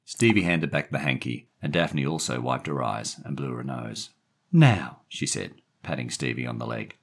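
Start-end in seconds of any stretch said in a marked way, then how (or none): 4.5-5.0 louder, higher opener; firm woman. A narrator's reading. Daphne turning to question the boy, firm woman. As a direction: call him to attention.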